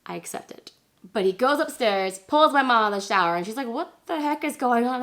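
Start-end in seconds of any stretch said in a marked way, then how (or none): 3.7-5.0 higher-pitched mock indignation; retold, baffled exclamation; bewildered mom. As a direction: imitate a mom demanding an explanation. Mom pulled from shower, retold.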